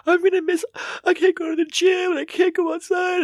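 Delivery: put-on high, whiny baby voice. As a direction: whine like a sick baby. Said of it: "I whine about".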